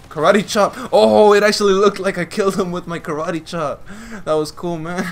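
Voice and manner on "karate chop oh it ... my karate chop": high, loud and amazed; thrilled outburst. Real, not acted. Gamer seeing his karate chop seem to kill, thrilled. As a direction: exclaim it loudly, delighted it worked.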